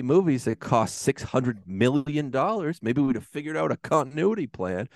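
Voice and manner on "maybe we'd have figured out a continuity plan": usual speaking voice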